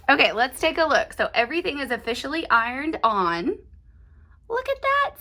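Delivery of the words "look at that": much higher, gleeful exclamation; delighted. Real, not acted. Crafter showing viewers the block, gleeful high. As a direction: show off the result with glee. Admiring the ironed-on result.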